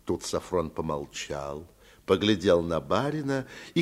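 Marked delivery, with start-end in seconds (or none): none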